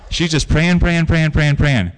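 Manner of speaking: on and on without a break; relentless repetition. Real, not acted. Conveying prayer that went on and on.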